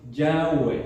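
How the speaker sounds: teacher demonstrates "Yahweh" for the class; demonstrated pronunciation. Shown as a demonstration. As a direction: show how "Yahweh" was likely said: "Yah-weh". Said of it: "la pronunciación es más o menos así".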